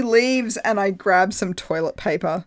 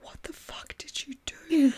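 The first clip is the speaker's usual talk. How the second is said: horrified hushed whisper, higher; 7 semitones higher, 5 dB quieter than usual. Retold or imitated. The girl covering her face, horrified whisper. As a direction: whisper it in disgust.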